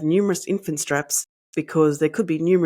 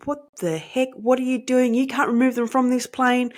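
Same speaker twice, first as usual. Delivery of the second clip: outraged passengers; 8 semitones higher than usual. Retold or imitated. Passengers protesting the removal.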